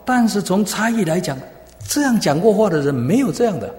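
high, loud pulpit delivery; emphatic preaching. Acted. Preacher explaining the officers' view to the congregation, emphatic preaching.

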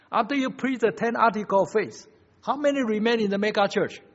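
high, loud pulpit voice; forceful preaching. Real, not acted. Preacher challenges congregation about mega churches, forceful preaching. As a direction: drive the question at the congregation.

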